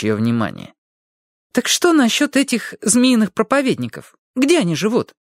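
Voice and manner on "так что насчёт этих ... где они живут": put-on higher, curious young girl; a young girl asking eagerly; girl's voice. A narrator's reading. Girl presses her aunt about the preachers, higher and curious. Harriet asks on the phone.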